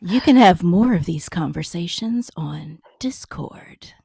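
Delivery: slow, hushed, sultry whisper; breathy seductive ad read; sultry perfume ad. Pretend. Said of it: "You have a very sexy, sultry voice on that".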